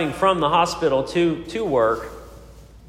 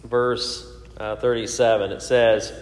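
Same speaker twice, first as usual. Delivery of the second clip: normal talking voice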